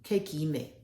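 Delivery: greeting spoken in a local tongue; "Kekime" as a native greeting; local language greeting. Shown as a demonstration. Teaching a listener a greeting, in a local tongue. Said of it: "Kekunume. That is".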